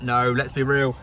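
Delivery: ordinary talk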